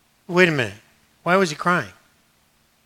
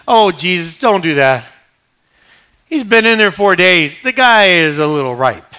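mock protest; 2 semitones higher, 10 dB louder, slower than usual. Voices Martha objecting to Jesus.

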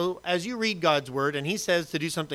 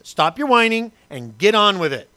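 louder, stern fatherly scold; stern dad; 3 semitones higher, 7 dB louder than usual. Retold or imitated. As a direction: scold the whining kids. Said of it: "You ever tell that to your kids".

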